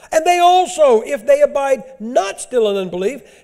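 loud, high scripture preaching; thundering sermon reading; full-force preaching. Real, not acted. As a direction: drive the verses home.

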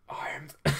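attempted breathy ghost whisper; ghostly voice. Ghost introducing himself to the hero, whispered. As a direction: begin a ghostly whisper, breaking off. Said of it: "said a ghostly voice".

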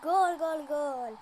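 loud goal scream, fading down; screaming football commentator. Real, not acted. Scores a goal. (yüksek sesle gol çığlığı)

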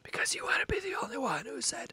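hushed reply, playing along; voiceless stage whisper; whispered teasing. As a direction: whisper back conspiratorially. Keeps up the whispered exchange.

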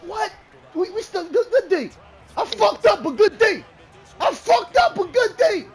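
high, loud celebratory yell; fired-up, full-voiced hollering; hyped shouting. Real, not acted. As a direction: shout it out, pitch climbing. Hyped after a defensive play.

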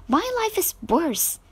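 put-on childish complaint. A narrator's reading. Amy butts in.

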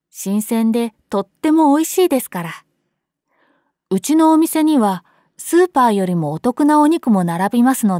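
bright, upbeat sales pitch; lively salesman. A narrator's reading. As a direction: sell it with friendly energy. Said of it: "はつらつとした口調だった".

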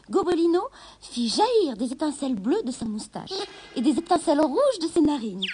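ordinary delivery, nothing marked

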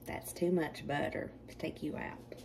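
a soft unvoiced whisper; whispered aside. Real, not acted. Cook whispering to herself about the butter, hushed. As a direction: breathe it as a private aside.

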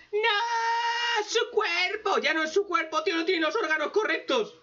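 high, yelling protest at the plot; outraged shout. Real, not acted. Reviewer yells at the story's nonsense, high outraged shout. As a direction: shout it in disbelief.

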